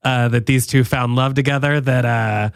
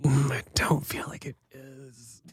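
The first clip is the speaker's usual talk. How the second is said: whispered, slow and doubtful; hushed disagreement; 11 dB quieter than usual. Real, not acted. Disputing the friend's take on love, hushed. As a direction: murmur it very softly, dragging it out. Mock hesitant disagreement in a bit.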